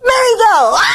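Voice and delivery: usual speaking voice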